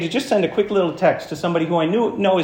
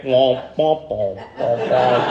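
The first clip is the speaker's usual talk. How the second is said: sad trombone; 5 semitones lower, much slower than usual. Retold or imitated. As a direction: lower sung womp womp. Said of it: "I'm hearing the music".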